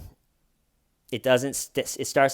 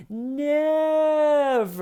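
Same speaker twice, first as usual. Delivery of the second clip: very high, louder "ever, never"; mock shrill denial; 6 dB louder than usual. Parody clip, Wendy squealing her denial. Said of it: "really funny video".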